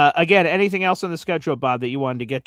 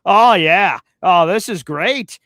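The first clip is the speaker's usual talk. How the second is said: gleeful; 4 semitones higher, 9 dB louder, slower than usual. Real, not acted. Gleeful at rival's bad schedule.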